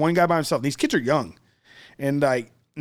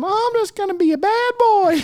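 much higher, put-on voice of a keen kid; eager kid voice; 5 dB louder than usual. Made-up kid in class asking about prison, high eager voice. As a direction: play a kid dying to get in.

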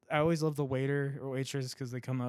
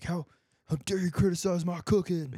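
mock outraged chef, a bit higher; angry chef; 4 semitones higher than usual. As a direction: play the offended chef, lightly. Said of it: "and he's like".

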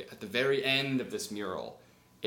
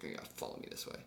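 bitter; 14 dB quieter, much faster than usual. Acted. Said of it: "he's kinda bitter".

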